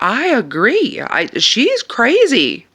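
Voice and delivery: high, loud, slow exasperation; fed up with the managers, high and drawn out; exasperated. Retold or imitated. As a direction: stretch it out in disbelief. Exasperated agreement.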